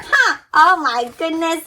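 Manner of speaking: normal talking voice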